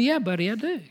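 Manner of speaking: put-on soft, gentle answer to a small boy; a father softly telling his boy yes; tender reassurance. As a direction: quietly reassure your little son. Father reassuring adopted son.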